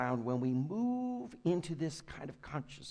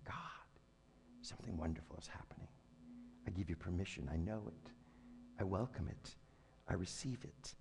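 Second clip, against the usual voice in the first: hushed prayer; 8 semitones lower, 12 dB quieter, faster than usual. Acted. Prayer voiced after "and saying.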